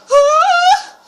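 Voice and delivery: sudden high scream. Real, not acted. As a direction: shriek at a jump scare. Jump scare scream.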